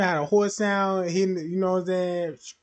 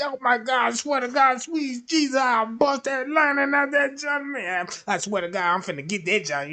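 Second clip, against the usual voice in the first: excited man; 9 semitones higher, 4 dB louder than usual. Retold or imitated. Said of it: "That man brain gonna be going all like this here".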